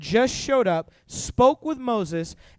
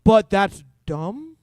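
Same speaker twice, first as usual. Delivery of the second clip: slow deadpan; 6 dB louder, much slower than usual. Retold or imitated. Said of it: "Moses is like".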